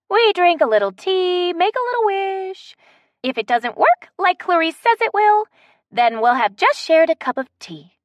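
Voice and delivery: put-on high, bright old lady coaxing; Betsy's voice. A narrator's reading. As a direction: coax them like a sweet old lady. Betsy's higher character voice.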